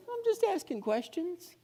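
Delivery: high, mock-innocent voice; the accuser feigning innocence to Eve; innocent serpent. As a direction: play the harmless one asking questions. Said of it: "He's just".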